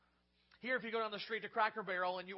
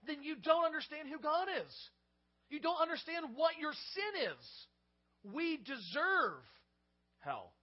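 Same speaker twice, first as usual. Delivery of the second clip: stern preaching; 7 semitones higher, 5 dB louder than usual. Acted. Rebuke of objection.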